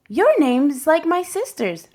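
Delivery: put-on young boy's happy discovery; excited boy. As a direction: blurt it out like a delighted kid. Rigoberto excited to classmate.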